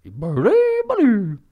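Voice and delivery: very high, loud, slow squeal; high, loud creature cry; Grimace creature call. Retold or imitated. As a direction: screech it like a cartoon bird. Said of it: "and he goes".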